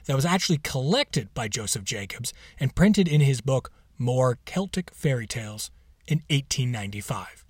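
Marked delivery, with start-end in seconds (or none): none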